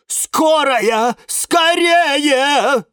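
high, loud panicked cry; panicked shout. A narrator's reading. Elderly rose shaking her branches, panicked shout. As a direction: shout for help in full panic. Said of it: "стала в панике трясти цветами".

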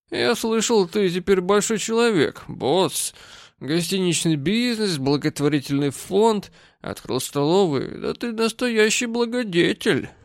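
mocking drunk praise; drunk mockery. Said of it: "Он был пьян".

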